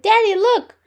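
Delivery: high, loud excited call to dad; excited child. A narrator's reading. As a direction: call out proudly to get Daddy's attention. Excited child shows off cart.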